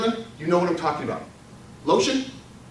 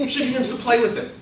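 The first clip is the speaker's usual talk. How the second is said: ordinary talk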